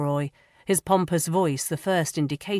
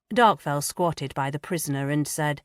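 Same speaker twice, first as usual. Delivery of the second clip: ordinary delivery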